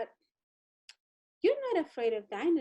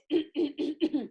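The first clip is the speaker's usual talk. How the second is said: throat clearing cough. Shown as a demonstration. Kid showing teacher his throat clearing. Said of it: "throat like this".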